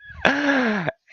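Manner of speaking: high teary laugh breaking out; a real laugh through emotion; emotional laugh. Real, not acted. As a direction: laugh it off, a bit choked up. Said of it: "I'm getting emotional".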